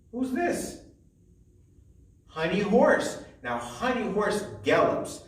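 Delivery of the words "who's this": squeaky high guessing-game question; high quiz voice. Shown as a demonstration. Teacher quizzing children in a high playful voice. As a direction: ask the kids like a playful puppet.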